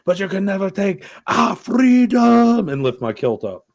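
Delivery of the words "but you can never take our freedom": higher and louder, slow mock rallying speech; rally the clans on a Highland hill; Braveheart battle cry. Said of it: "I wanna go".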